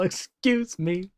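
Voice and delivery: small, slow, soft mock-polite "excuse me"; dainty mimicked excuse me. Reacting streamer mimics friend's tiny polite excuse me. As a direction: echo her timid little request softly and slowly. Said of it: "That little".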